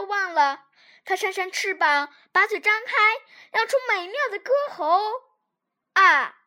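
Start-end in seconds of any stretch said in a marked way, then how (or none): 5.9-6.4 a loud, grating caw; harsh crow squawk. Acted. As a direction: screech it out like a crow. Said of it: "随着一声刺耳的声调".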